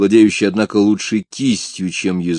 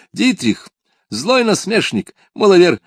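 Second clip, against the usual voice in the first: high, loud royal summons; exclaiming king; 10 semitones higher, 4 dB louder than usual. A narrator's reading. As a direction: call him over with booming delight. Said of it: "воскликнул король".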